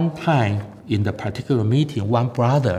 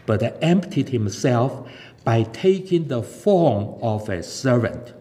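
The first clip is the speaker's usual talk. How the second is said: measured, slightly raised reading of scripture; 3 semitones higher than usual. Acted. Preacher closing the sermon with Philippians, solemn reading. Reads Philippians chapter two.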